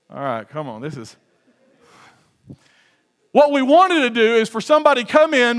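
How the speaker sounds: ordinary talk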